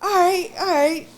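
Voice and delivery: put-on Jamaican-English accent. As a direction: drawl it with a Jamaican-English lilt. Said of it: "it was English, it was Jamaican".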